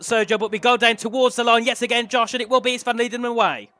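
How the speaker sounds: loud rising play-by-play; excited race commentary. Real, not acted. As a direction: build to the finish line call. Live race play-by-play, finish.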